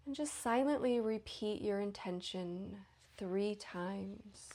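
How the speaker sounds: unhurried, even meditation voice, lingering between phrases; guided meditation. Real, not acted. Leads guided yoga nidra.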